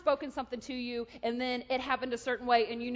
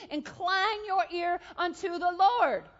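slow, loud, raised preacher's proclamation; forceful proclamation; 5 semitones higher, 7 dB louder, slower than usual. Retold or imitated. God telling the speaker to listen, slow ringing command. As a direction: draw it out with full pulpit force. Climax of God's speech.

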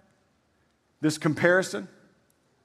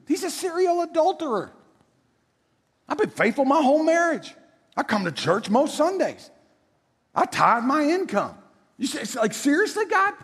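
higher, indignant mock whine; self-righteous complaint; 8 semitones higher than usual. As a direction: complain to God like a smug churchgoer. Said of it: "We say things like".

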